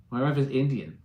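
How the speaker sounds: ordinary talk, nothing marked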